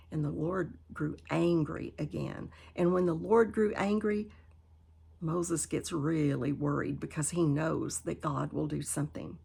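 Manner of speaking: ordinary delivery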